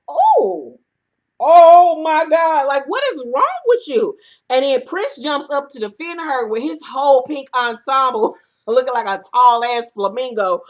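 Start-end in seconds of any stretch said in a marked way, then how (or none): 0.1-4.2 higher, louder, slow drawn-out gasp of shock; shocked disbelief. Retold or imitated. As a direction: react like you can't believe your eyes. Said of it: "And I'm like".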